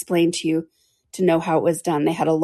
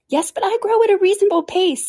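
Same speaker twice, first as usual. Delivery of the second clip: Dormouse's voice; 14 semitones higher than usual. Acted. Dormouse scolds Alice.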